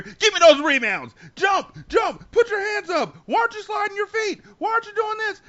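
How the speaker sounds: high, loud sideline shouting; coach yelling. Retold or imitated. Dad coaching his daughter in practice, yelling orders. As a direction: bark rapid orders across the court.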